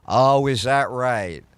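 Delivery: slow, low, dry sarcasm; scoffing back at the smug weather anchor; sarcastic. Retold or imitated. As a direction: drag it out, unimpressed.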